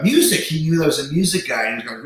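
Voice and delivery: ordinary talk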